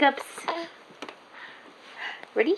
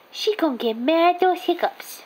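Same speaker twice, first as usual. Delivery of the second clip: ordinary delivery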